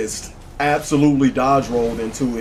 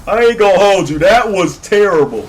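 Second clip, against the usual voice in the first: loud, high-pitched exclaiming after a laugh; laughing outrage; 9 semitones higher, 10 dB louder than usual. Real, not acted. Player judging a terrible cross heal, outraged and amused. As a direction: protest loudly, still tickled by it.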